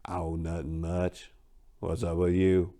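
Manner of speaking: low, quiet and slow, not looking up; low-key slacker voice for Sly; plain answer. A narrator's reading. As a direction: toss it off flatly, barely bothered. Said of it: "he answered back plainly".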